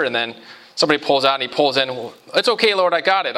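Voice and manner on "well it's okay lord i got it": light, higher-pitched imitation of waving off help; mock breezy dismissal. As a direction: lightly act out a casual "never mind". Latecomer breezily dismisses God's help.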